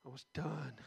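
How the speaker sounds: unvoiced, choked whisper with no breath left; a real gasping whisper through tears; breathless whisper. Real, not acted. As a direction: speak on almost no air, overcome. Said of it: "I couldn't even hardly breathe".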